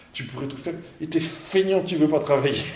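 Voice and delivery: reproche moqueur, plus aigu et fort; reproche imité. Retold or imitated. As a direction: imiter un copain qui secoue un paresseux. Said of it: "un gars qui est à côté me dit". (le copain reproche au blondinet sa paresse, ton aigu et fort)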